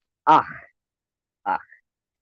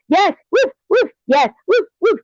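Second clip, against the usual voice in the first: very high, yappy pretend dog; eager dog voice. Commentator voicing the silenced dog, high yappy woof. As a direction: voice a dog begging to bark. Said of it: "the dog wants to be like".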